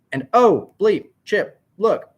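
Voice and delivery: high, loud urgent exclamations; startled commentator bursts; excited alarm. A narrator's reading. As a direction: grab a co-host's attention fast. Commentator spots sudden action in crowd.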